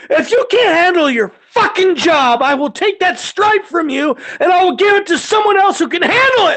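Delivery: high, forceful angry threat; re-enacted yelling. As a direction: chew out a subordinate at full voice. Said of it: "it's about to get yelling".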